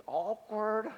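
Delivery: drawn-out wry aside; a wry aside to the congregation; deadpan awkward. Acted. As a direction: let the word hang, wry and knowing. Comic aside again.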